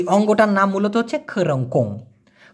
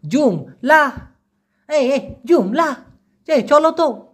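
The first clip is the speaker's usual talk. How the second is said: higher, calling someone along; urging call; 5 semitones higher, slower than usual. Shown as a demonstration. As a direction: call out to hurry a friend. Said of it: "একটু আলাদা করে বলে".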